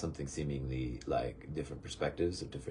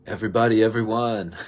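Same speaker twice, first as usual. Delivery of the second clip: loud, higher call to everyone, breaking into laughter; loud greeting; 5 semitones higher, 12 dB louder than usual. Real, not acted. Speaker greets everybody and laughs about the egg, loud greeting. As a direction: hail the crowd at full volume, then crack up.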